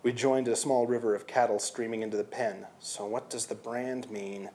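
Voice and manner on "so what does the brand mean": much softer and slow; hushed. Acted. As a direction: ask it under your breath. Quiet question in pen.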